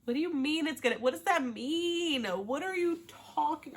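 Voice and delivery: indignant questioning. Real, not acted. Viewer challenging the groom's reassurance, indignant and high. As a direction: demand it up high, incredulous.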